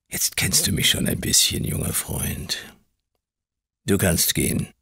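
cold whisper dismissing him; whispered command. A narrator's reading. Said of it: "befahl er".

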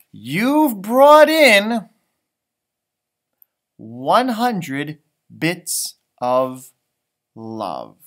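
slow, swelling then softening; heartfelt praise. A narrator's reading. Teacher's heartfelt verdict.

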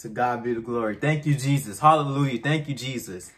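exultant praise. Real, not acted. Partner thanking God for pregnancy, exultant praise. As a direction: shout praise in real gratitude.

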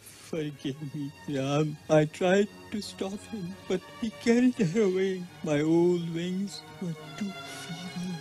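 high, halting, strained voice; dying apology. Acted. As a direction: plead forgiveness with failing strength. Dying Jatayu asks forgiveness.

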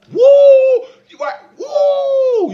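high, slow stretched whoop; mock triumphant yell; whooping shout. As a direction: let out a big drawn-out woo. Comic shout.

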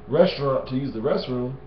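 usual speaking voice

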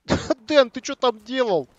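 shouted laughing question at teammate; loud call-out. Real, not acted. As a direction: yell across the room, half laughing. Shouting at teammate mid-game.

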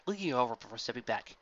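ordinary delivery, nothing marked

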